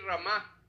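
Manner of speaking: Ramá with the accent landing on the last A; stress on final A. Shown as a demonstration. Teacher explaining a Hebrew word to church, Ramá stressed on final A. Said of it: "Con acento en la A".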